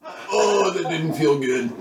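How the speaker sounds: high, laughing mock groan of pain; imitating a friend's pained muffled voice. Mocking a friend who fell off his longboard, high and laughing. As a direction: play the friend who just wiped out, half laughing. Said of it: "hear your muffled voice in the distance".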